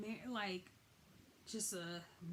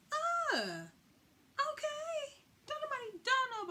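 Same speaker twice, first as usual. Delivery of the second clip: high, stunned; shocked; 4 dB louder than usual. Retold or imitated. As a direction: gasp out a shocked okay. Wife introduces husband, mock shock.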